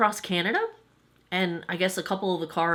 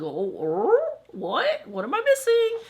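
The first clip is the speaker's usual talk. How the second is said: surprised; 12 semitones higher, slower than usual. Retold or imitated. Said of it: "I was like".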